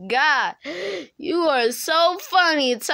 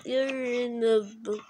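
usual speaking voice

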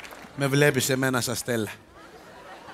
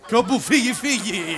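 high burst, as if bolting; sudden panicked getaway; 8 semitones higher than usual. Acted. Instructor's last defence step is fleeing, high sudden cry. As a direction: blurt it out while making a run for it. Punchline: flees after the steps.

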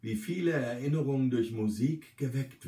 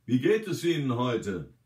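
louder, clear question to be heard; raised voice; 2 semitones higher, 5 dB louder than usual. A narrator's reading. Narrator asks the resident how she is today, raised voice. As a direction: speak up for a listener hard of hearing. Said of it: "damit sie mich auch hört".

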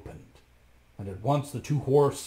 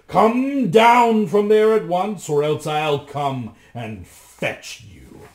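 much louder, slow, furious bellow; shouting loudly; 5 semitones higher, 12 dB louder, slower than usual. A narrator's reading. Bluebeard bellowing at his wife to come down. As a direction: roar the order, slowly. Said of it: "shouted as loud as he could".

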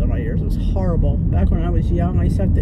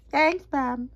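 dog voice; 5 semitones higher than usual. Pretend. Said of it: "Yes, I talk for my dog".

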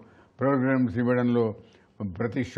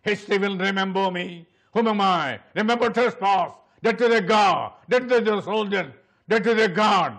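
raised, commanding epic proclamation; Ten Commandments film scene; 5 semitones higher, 4 dB louder, much slower than usual. Shown as a demonstration. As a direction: build from slow declaration to loud orders.

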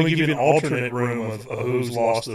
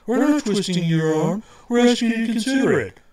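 mock editors; 9 semitones higher than usual. Editors voiced in retold meeting.

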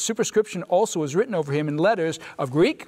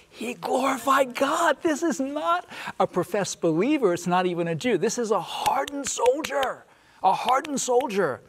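high-pitched, fervent pulpit proclamation; impassioned preaching; 8 semitones higher than usual. Real, not acted. Preacher marvels to his panel at the centurion's conversion, impassioned preaching.

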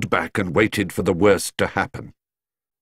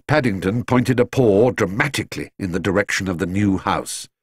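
plain reading voice

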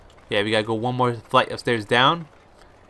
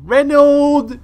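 calling out; 12 semitones higher, 8 dB louder than usual. Acted. Sister calls brother's name.

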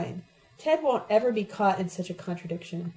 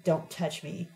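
cold low hiss through the teeth; 3 semitones lower, 4 dB quieter, slower than usual. A narrator's reading. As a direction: spit it out quietly through clenched teeth. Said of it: "she hissed through her teeth".

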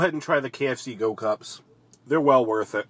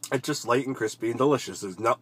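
talking while chewing; muffled through food; mouth full. Real, not acted. Seen 1: chicken bitten before; cheeks bulge, lips pursed mid-speech.

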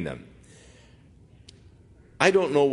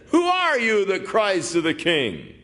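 Abner calling back; 9 semitones higher than usual. Acted. Abner answering David from afar, calling out high. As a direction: shout back across the hills. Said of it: "Abner answered and said".

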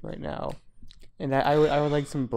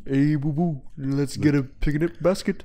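Yogi Bear voice. Acted. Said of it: "Do Yogi Bear".

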